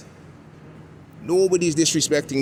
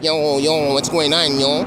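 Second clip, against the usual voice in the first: imitated Toronto East End accent, pitched up; high-pitched Toronto accent; 4 semitones higher, 4 dB louder than usual. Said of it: "that high-pitched accent".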